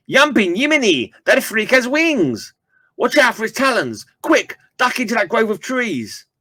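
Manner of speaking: high, urgent hero voice, pitched well up; excited comic hero voice. Acted. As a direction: shout a warning from a comic panel, high. Comic character voice raised high.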